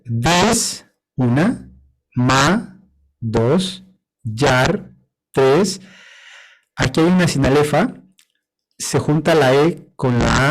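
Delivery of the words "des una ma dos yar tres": teacher splits the verse into syllables, counting each; syllable count read aloud. Shown as a demonstration. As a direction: tap out each syllable then its number. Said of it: "Fíjense".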